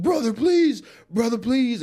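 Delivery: high, loud pleading imitation; mock begging. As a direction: whimper like a beaten man pleading for mercy. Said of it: "started begging me".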